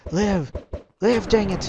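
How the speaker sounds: high, loud pleading shout; urgent yell. Real, not acted. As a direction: yell at them to live. Urging his team to survive.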